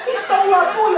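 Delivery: high, loud accusing mother; outraged mom. Acted. Mom accusing Uncle Leroy to her son, outraged. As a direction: raise pitch and volume in indignation.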